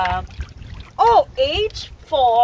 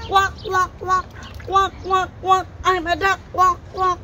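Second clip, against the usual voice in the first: higher-pitched quacking duck voice; pretend duck; 5 semitones higher than usual. Shown as a demonstration. Parent shows child "like this", high duck quacks. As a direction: play a duck, quacking in short bursts. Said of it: "Can you do like this?".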